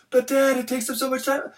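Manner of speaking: complaining daughter. Retold or imitated.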